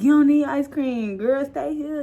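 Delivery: high loud begging. Retold or imitated. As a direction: plead with her to stay. Begging mom to stay.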